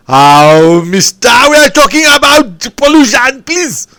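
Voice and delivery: loud, high cry of mock exasperation; exasperated protest. Real, not acted. Host cutting off a rambling guest, loud exasperated protest. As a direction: throw up your hands and cut him off, loud and high.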